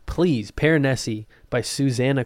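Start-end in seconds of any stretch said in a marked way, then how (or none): none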